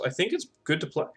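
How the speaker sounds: talking through hiccups; a hiccup breaking the words. Real, not acted. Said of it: "Excuse me, I'm hiccuping".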